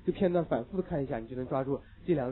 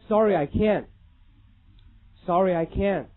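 put-on American accent; 3 semitones higher, 5 dB louder, slower than usual. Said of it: "他经常会这么回答你的问题".

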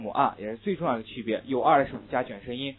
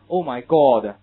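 British oh my God; 10 dB louder, much slower than usual. Retold or imitated. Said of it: "没有像英国人读过".

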